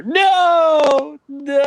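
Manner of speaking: hammed-up anguished high shout; stilted old movie melodrama. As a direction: wail it out like a ham actor. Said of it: "hammy acting from Mark Hamill".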